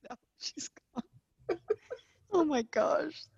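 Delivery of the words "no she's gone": slow, soft laughing words. Real, not acted. Laughing after mother leaves.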